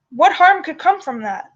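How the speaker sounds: usual speaking voice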